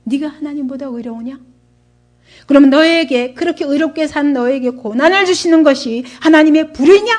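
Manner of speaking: acted reproof, building to a loud challenge; rebuking. Elihu scolds Job for claiming righteousness, rebuking. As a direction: start contained, then hammer the final question. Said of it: "엘리후가 책망을 합니다".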